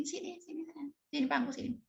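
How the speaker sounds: very soft, coaxing call; with tenderness. Retold or imitated. Mother coaxes hesitant daughter onto her lap, very soft. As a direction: gently beckon a wary child. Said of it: "memanggil dia dengan kelembutan".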